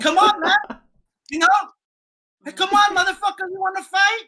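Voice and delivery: high, loud retold yell picking a fight; angry shout. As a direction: shout him down like you're ready to swing. Retelling challenging the customer to fight.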